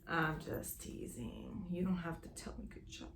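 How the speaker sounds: very soft, low pout; mock pouting. Sewist fishing for a compliment, pouting softly. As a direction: pout it almost under the breath. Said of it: "I was pouting for no reason".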